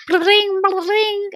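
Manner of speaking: high sung bling bling; high playful harp notes; imitated harp plucks.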